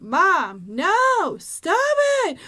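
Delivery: put-on high, loud sleep-talking child yelling; kid yelling in sleep. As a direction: imitate a kid shouting in her sleep. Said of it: "like, yell out".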